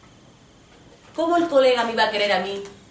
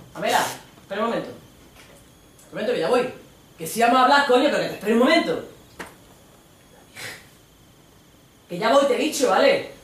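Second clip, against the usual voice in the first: voz de Antonio, alto y agobiado; la calma mientras se irrita; Antonio impatient; 5 semitones higher, 4 dB louder than usual. Acted. Antonio responde a la novia. (Antonio apurado con la novia)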